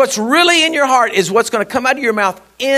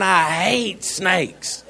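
slow emphatic; 4 dB quieter, much slower than usual. Real, not acted. Emphatic dislike, laughing.

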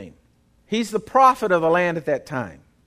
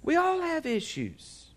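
repeats it higher, softer and slowly; a gentle raised echo of the point; 9 semitones higher, 7 dB quieter, slower than usual. Shown as a demonstration. Pastor softly echoing his point to the congregation, high and slow. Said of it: "Are y'all with me?".

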